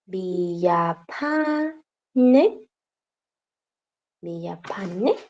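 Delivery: "Villapane" with P for F and plain N. Shown as a demonstration. Teacher trying the surname without ñ, "Villapane" with a plain N. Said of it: "no existe tal cual el sonido de la ñ".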